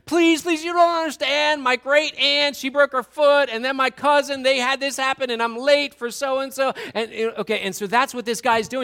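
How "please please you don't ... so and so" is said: high, rushed mock pleading; hammed-up excuses to a cop; pleading driver. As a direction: driver begging the officer, higher and louder. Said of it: "the guy in the car with the police officer".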